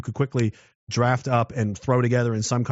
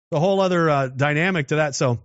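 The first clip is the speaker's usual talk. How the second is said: ordinary talk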